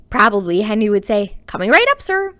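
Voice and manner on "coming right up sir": high, loud cheerful servant voice; eager butler. Acted. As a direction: snap to it like a bright steward.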